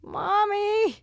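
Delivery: put-on high, loud kid confession; high little boy voice. Mom voicing her son's messy admission. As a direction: squeak it like a toddler caught out. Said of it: "He's like".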